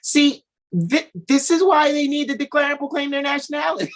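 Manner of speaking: high, loud, laughing mock voice; impersonation of partner. Wife teasing husband about how he talks, high loud laughing impersonation. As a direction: ham up his outraged pronouncement while cracking up. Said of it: "do your impersonation of you".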